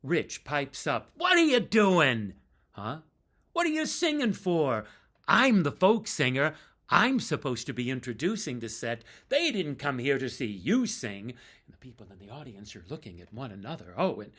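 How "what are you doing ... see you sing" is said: loud, high indignant scolding; mock outrage. Bandmate scolding the singer onstage, loud mock outrage. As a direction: ham up a furious bandmate.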